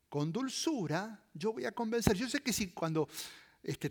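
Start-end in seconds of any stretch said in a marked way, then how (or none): none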